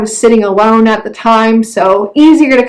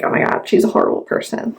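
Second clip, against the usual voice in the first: whispered; 8 dB quieter, faster than usual. Retold or imitated. Voicing a judgmental reaction.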